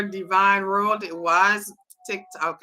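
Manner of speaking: ordinary delivery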